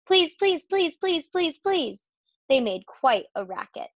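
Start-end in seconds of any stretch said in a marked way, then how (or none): 0.1-2.1 high, pleading chant of please; teasing goslings. A narrator's reading. Seven goslings pestering their mother, high pleading chorus. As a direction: nag like a crowd of little geese. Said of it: "all seven began teasing to go".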